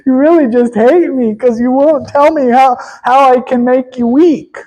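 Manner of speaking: lightly whiny pleading lover; a hint of tearful begging; mock crying and begging. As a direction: whine it like a half-hearted sob. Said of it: "crying and begging".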